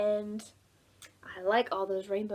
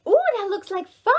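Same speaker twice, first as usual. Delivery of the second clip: high, louder mouse voice; eager mouse; 10 semitones higher, 12 dB louder than usual. Acted. Storyteller voicing the yellow mouse, high and excited. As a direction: coo it like a delighted little mouse. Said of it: "said the yellow mouse".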